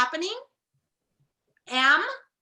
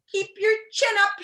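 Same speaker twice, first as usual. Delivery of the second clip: shout; 9 semitones higher, 6 dB louder than usual. Acted. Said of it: "shouted the humbug".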